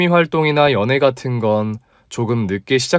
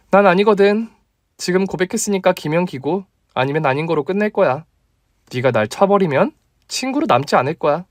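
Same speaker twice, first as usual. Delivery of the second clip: put-on bold young woman insisting; female friend demanding; 6 semitones higher than usual. Female colleague voiced. (높고 다그치는 여자 목소리)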